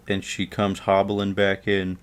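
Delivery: usual speaking voice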